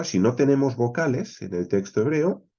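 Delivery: usual speaking voice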